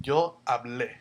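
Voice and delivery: Yo hablé with a stressed final é; Spanish conjugation. Shown as a demonstration. Teacher repeating the form for students, clear Spanish. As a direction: say Yo hablé, leaning on the é. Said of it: "E with an accent".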